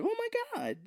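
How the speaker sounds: very high soft gushing oh my God; mock gasp. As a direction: swoon in mock delight.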